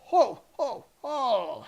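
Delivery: loud, high, hearty ho ho ho; booming goblin ho ho. Acted. Old goblin booms his surprise at the whisper. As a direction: bellow the laugh out.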